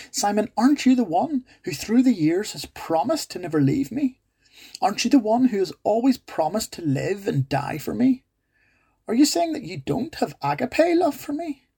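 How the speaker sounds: hurt teacher questioning his disciple, rising; pleading Jesus. Acted. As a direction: plead with a wounded friend. Preacher voices Jesus pleading.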